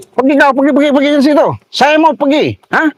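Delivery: high, insistent angry yelling; angry order. Real, not acted. Driver telling the blocker to move, high angry yell. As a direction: order him away in a high, urgent voice.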